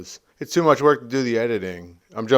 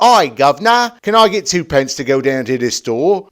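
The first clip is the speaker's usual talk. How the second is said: loud, broad comic Cockney; over-the-top Cockney accent; silly Cockney; 6 dB louder than usual. Pretend. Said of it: "straight up silly Cockney".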